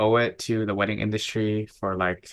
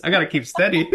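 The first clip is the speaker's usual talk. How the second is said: laughing; 9 semitones higher, 4 dB louder than usual. Retold or imitated. Retold panic, breaking into laughter.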